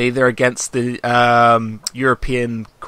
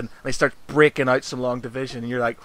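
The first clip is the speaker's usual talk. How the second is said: usual speaking voice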